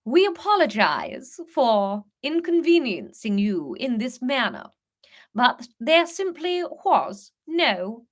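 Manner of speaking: lightly voiced menacing Queen in posh English; a hint of a sinister royal kidnapper. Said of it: "worked really weirdly well as the Queen".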